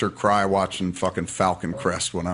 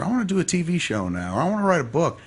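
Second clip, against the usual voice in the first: higher-pitched, lightly acted enthusiasm; eager dreamer; 8 semitones higher than usual. Dreamer announcing a new whim, lightly acted eagerness.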